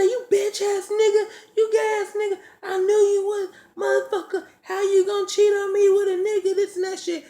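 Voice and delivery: mimicked girlfriend's high, loud screeching rant; furious berating. Girlfriend berating her cheating boyfriend, mimicked furious screech. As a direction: imitate her tearing into him, voice way up.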